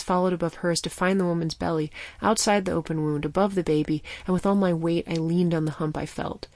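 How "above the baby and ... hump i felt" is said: plain reading voice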